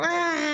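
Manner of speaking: very high, long comic scream; mock terrified scream. Storyteller riding at ninety miles an hour, mock scream. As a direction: scream as if hanging on for dear life. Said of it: "I'm scared for my life".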